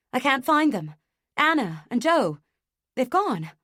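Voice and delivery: loud, high panic; panicked. A narrator's reading. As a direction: blurt it out in panic. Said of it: "The words explode out of me with a sob".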